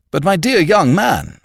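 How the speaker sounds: high, loud, dismayed protest; distressed cry. A narrator's reading. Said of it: "cried Mister Gowler, distressed".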